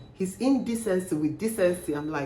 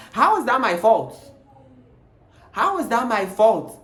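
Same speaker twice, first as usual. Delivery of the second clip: louder, higher, indignant repeat; 5 semitones higher, 6 dB louder than usual. Retold or imitated. Tenant defending himself to the landlord, indignant.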